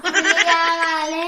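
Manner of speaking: loud, slow sobbing wail; crying tantrum. Acted. Child insisting on a tiger, bawling. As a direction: drag it out in a bawl. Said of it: "ఊకే ఏడ్చి".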